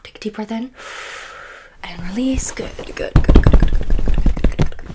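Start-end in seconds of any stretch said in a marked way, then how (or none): 2.5-4.9 rapid burst of whispered goods; fast aggressive asmr. Acted. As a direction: spit the words out fast and hard. Rapid-fire ASMR tapping words.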